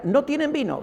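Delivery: high-pitched quote of Mary, falling at the end; quoted hint. Retold or imitated. Preacher quotes Jesus' mother saying the wine is gone, high-pitched hint. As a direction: lift it high, then let it fall.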